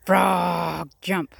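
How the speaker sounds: loud shout with long-held frog; acted yell. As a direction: bellow one last command at the frog. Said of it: "he yelled".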